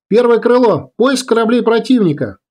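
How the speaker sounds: high-pitched military order; ringing command. A narrator's reading. Leader sending first wing searching, ringing command.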